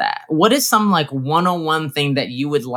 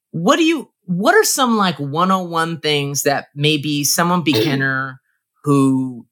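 normal talking voice

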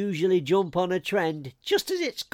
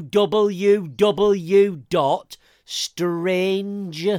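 very slow, each letter spaced, louder; slow spelled-out repeat; 3 dB louder, much slower than usual. Shown as a demonstration. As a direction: spell it out for someone dazed. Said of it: "My head is still ringing".

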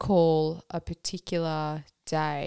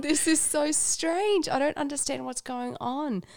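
high, laughing disbelief at her own tears; retelling her baffled self, laughing and higher; bewildered laughing; 10 semitones higher, 7 dB louder than usual. Retold or imitated. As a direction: laugh through the confusion, pitched up. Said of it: "And then I was like".

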